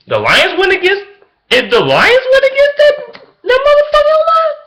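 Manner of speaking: cracking high excited squeal. Real, not acted. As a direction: voice jumps way up, squeaky with hype. Hyped about Lions defensive line.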